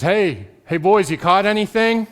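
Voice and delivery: Jesus calling to disciples on the lake, loud hail; calling out across water. Acted. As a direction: shout out to the fishermen from the shore.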